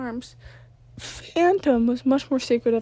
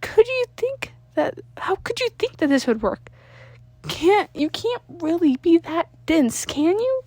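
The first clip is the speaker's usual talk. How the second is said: high, louder incredulous scolding; exasperated Sam; 7 semitones higher, 5 dB louder than usual. Acted. Sam scolding Danny, high-pitched and exasperated. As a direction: scold him, unbelieving. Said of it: "slapped him upside the head before sighing".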